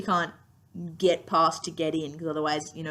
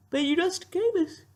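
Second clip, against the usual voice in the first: words stretched by a yawn; 10 semitones higher than usual. Real, not acted. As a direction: talk through a yawn. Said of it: "Sorry, I'm yawning".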